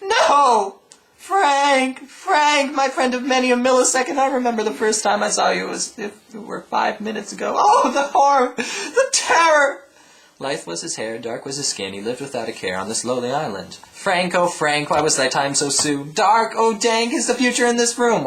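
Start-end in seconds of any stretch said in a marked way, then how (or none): 0.0-9.9 high, loud wailing grief; loud high melodramatic lament; hammed-up lament. Mourning Frank's death. 13.9-18.2 recited like a mock poem; hammed-up funeral poem; mock elegy. Poetic eulogy for Frank.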